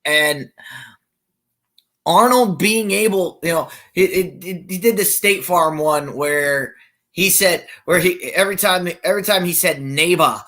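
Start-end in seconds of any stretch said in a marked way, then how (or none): none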